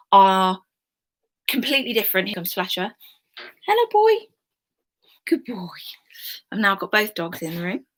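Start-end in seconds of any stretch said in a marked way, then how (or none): 3.7-4.2 high sing-song dog greeting; talking to the dog. Real, not acted. 5.3-5.7 gentle pet talk; soft dog praise. Real, not acted.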